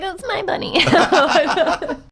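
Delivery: high, slow cooing over a pet bunny; a guest mocking people who dote on a bunny, high and slow. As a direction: coo it like a doting pet owner. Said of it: "Everyone's like".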